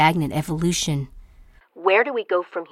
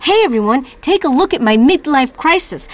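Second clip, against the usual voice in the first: higher, louder sarcastic barker call; mocking announcement; 7 semitones higher, 4 dB louder than usual. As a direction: parody a show-off calling a crowd. Mocking theatrical announcement, repeated.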